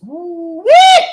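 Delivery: loud, high whooping ooh-wee; ooh-wee exclamation. Real, not acted. To viewers about the danger, a loud high whoop. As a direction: let out a shocked whoop.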